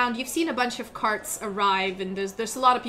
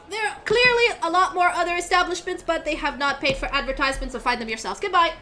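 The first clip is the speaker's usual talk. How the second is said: dismissive crier; 6 semitones higher, faster than usual. Acted. Crier kid signs off.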